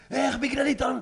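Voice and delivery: high-pitched retold protest; indignant comeback. Storyteller acting the bachelorette party woman, indignant high retort.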